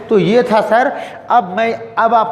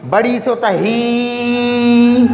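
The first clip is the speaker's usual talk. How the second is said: slow demo; 3 semitones higher, much slower than usual. Shown as a demonstration. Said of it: "उसके बाद बड़ी".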